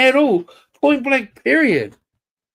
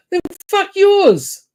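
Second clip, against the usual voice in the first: high, slow, furious payback; angry retort; 10 semitones higher, slower than usual. Real, not acted. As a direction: drag out the insult in rage. Angry rant about the war dead.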